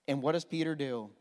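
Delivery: ordinary talk, nothing marked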